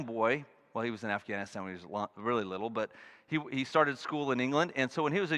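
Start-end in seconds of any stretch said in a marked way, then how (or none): none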